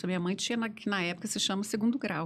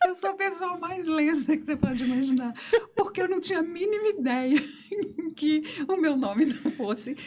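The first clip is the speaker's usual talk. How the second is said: talking through giggles; high giggly laughing telling; 6 semitones higher than usual. Real, not acted. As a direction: laugh while confessing. Laughing while telling of own slowness.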